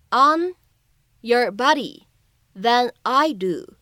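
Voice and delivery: slow, word by word with pauses; slow teaching read. Shown as a demonstration. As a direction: break the line into slow chunks for learners. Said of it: "我们需要注意哪些发音技巧呢".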